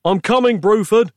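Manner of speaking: high-pitched urgent yell. A narrator's reading. Nick bursting into the scrapyard to save Bruford, yelling. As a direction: call out as you rush to help. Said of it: "yelled Nick".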